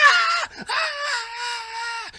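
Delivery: long, very high screams; hysterical screaming. Acted. Comedian answers the knocking neighbor, screaming hysterically. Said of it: "I'm in there screaming".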